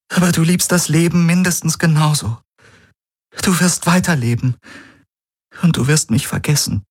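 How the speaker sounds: high voice, sadly certain; Key, sad and sure. Acted. Key tells Galen he will forget her, high and sad. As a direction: tell him gently he will live on.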